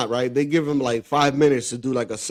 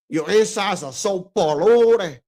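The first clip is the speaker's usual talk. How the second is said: put-on slow, drawn-out Cuban accent; 4 semitones higher, slower than usual. Showing friends how he said it, slow Cuban accent. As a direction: stretch it out in a Scarface Cuban accent. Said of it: "with the accent".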